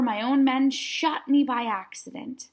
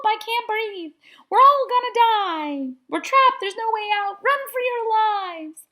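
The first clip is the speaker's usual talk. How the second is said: high, loud mock panic in the dark; panicked kids; 9 semitones higher, 3 dB louder than usual. A narrator's reading. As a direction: cry out like trapped, frightened kids. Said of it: "a jumble of voices over my head".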